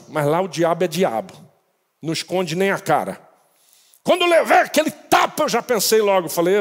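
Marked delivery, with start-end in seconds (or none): none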